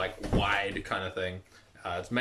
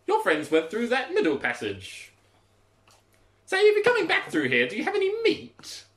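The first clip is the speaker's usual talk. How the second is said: high creature voice, climbing much higher; hungry female creature; 4 dB louder than usual. Acted. Creature asking a traveller for meat, high and eager. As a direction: pipe up hopefully in a high creature voice. Game master plays a creature.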